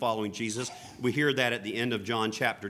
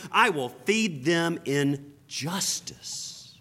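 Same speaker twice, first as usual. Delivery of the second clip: slow, solemn preacher's reading of scripture; 4 semitones higher than usual. Acted. Preacher reading Ezekiel to the congregation, solemn and slow. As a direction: proclaim God's words slowly and with weight.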